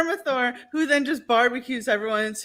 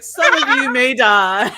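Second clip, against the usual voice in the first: loud, slow mock villain decree; lightly hammed-up Lord Farquaad voice; 8 dB louder than usual. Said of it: "Lord Farquaad, and he's like".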